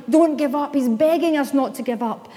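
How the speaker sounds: ordinary delivery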